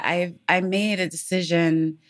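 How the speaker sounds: ordinary talk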